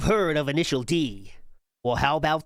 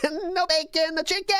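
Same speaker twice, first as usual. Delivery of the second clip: high mocking voice; 13 semitones higher than usual.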